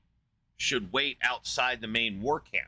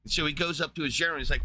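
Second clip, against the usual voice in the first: normal talking voice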